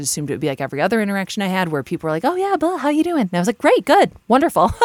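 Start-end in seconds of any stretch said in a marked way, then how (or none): 2.2-3.2 much higher, sing-song fake cheer; mock breezy greeting. Mimicking acquaintances with a high chirpy hello. 3.6-4.7 much higher and louder forced brightness; fake cheerful. Widow faking brightness to a friend, high forced cheer.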